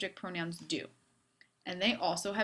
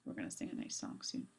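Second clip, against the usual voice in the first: whispered aside; 14 semitones lower, 11 dB quieter, faster than usual. Real, not acted.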